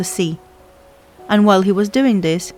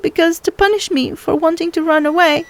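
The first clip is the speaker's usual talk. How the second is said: put-on high, sorrowful young girl voice; a sea girl saying farewell forever, raised and sad; 8 semitones higher than usual. A narrator's reading. Girl from the sea tells the boy they can never meet again, high and sorrowful.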